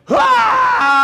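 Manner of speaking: high, loud startled scream; shrill boy's scream; kid's scream. Retold or imitated. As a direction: let out a high shriek. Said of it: "Wally goes".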